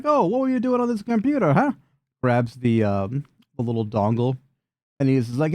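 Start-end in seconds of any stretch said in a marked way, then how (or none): 0.0-1.7 very high, mock-innocent villain voice; high, teasing question; prying villain. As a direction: needle them in a high character voice.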